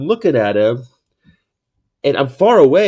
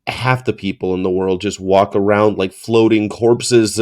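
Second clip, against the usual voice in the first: usual speaking voice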